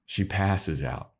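ordinary talk, nothing marked

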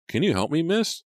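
"help me, miss?" rising sharply to a high finish; impatient customer. Acted. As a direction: call out to get her attention. Impatient man calls to clerk.

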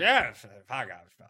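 put-on slow, slurred small-town Canadian accent; rough local Canadian accent, half drunk; drunk hometown accent. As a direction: drop the broadcaster voice, slur like a drunk Canadian. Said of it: "where I'm from starts coming out and I lose the professional broadcaster voice".

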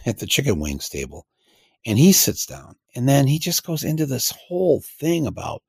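usual speaking voice

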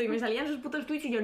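ordinary talk, nothing marked

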